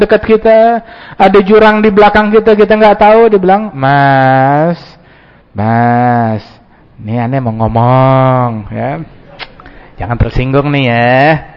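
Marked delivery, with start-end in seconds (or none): 3.7-11.5 low, slow, overly careful hedging; hesitant timid voice. Acted. False friend hedging before warning about a snake, timid low voice. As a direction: tiptoe around the point.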